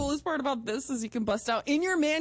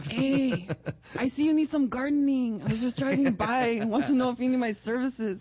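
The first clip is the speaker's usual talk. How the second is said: louder, high hammed-up pickup line; mock Mexican gardener; 4 semitones higher, 4 dB louder than usual. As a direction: hit on her as a cheesy gardener.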